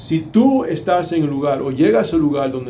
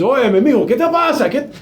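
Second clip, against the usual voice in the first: much higher and louder, demanding; sharp scolding; 8 semitones higher, 4 dB louder than usual. Shown as a demonstration. Father demanding what is wrong with his son, sharply. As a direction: snap at him to get his attention. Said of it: "A veces".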